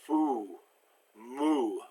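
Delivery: Foo and moo as French fou, mou, lips pursed; tongue back, lips tight for Foo, moo. Shown as a demonstration. Teacher drilling a hard vowel, pursed French ou. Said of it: "Lips pursed".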